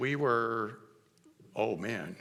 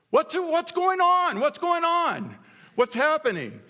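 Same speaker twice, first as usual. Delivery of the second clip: put-on loud little kid; 8 dB louder than usual. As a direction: shout it like a curious four-year-old. Loud four-year-old at baptism.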